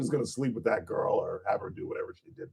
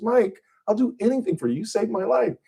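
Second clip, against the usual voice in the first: high woman's voice; 12 semitones higher than usual. Retold or imitated. Joke, Bardot voiced higher.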